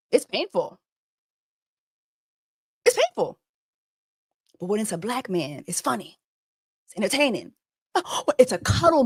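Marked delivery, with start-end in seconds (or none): 0.1-3.4 high, mocking whimper. Real, not acted. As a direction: squeak it like a fake victim. Mock whining.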